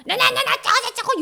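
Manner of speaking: put-on high, loud childish taunting; mock insult yelling. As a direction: bicker like a rude kid.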